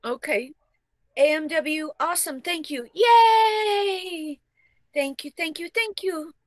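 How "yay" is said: high, loud yay; happy cheer. Real, not acted. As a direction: celebrate the tip. Cheering a tip.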